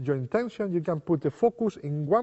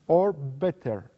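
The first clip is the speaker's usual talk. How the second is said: or better drawn out slowly; much slower than usual. Shown as a demonstration. Magician steering the spectator's choice, slowly. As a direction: let or better hang slowly. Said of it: "sometimes I use".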